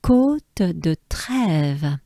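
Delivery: Côte de Trèves said very slowly and carefully. Shown as a demonstration. Teacher carefully models Côte de Trèves, very slow. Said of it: "they will climb".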